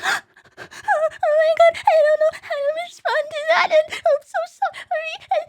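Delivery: very high, slow mock crying whine; panicked apology. Mocking flustered clients, high mock sobbing. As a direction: fall apart in mock tears.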